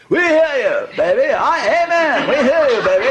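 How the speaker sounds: high, loud call-back; congregation response. Retold or imitated. Imitating the congregation answering the preacher, loud. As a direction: shout back like a revival congregation. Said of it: "congregation was saying".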